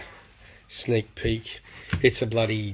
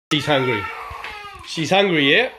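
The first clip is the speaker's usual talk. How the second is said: loud remark; 5 semitones higher, 8 dB louder than usual. Real, not acted. Loud remark about the pet.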